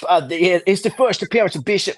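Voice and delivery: a bad, half-hearted Australian accent; lightly voiced Australian; mock Australian accent. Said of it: "That was supposed to be an Australian accent".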